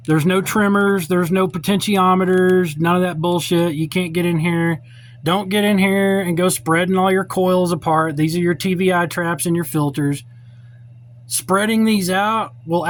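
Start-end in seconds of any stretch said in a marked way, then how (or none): none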